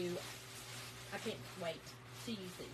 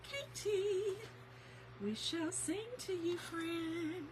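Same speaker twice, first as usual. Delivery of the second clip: singsong; 8 semitones higher, 6 dB quieter, much slower than usual. Real, not acted. Singsong to viewer.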